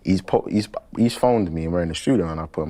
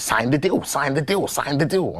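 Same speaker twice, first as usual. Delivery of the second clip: higher gremlin chant, over and over; 8 semitones higher than usual. Retold or imitated. Manager repeating the demand in a gremlin voice. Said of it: "a gremlin".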